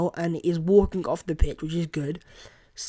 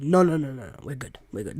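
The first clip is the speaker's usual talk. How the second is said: ordinary delivery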